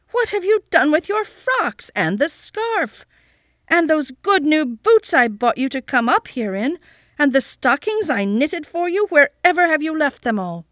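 high, loud, exasperated aunt calling out; high-pitched cross shouting; shrill scolding. A narrator's reading. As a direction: shriek at the child from across the slope. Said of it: "she called out shrilly".

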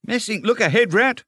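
high, urgent warning; sudden alarmed cry. A narrator's reading. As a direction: cry out to warn of a crash. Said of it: "cried the mole suddenly".

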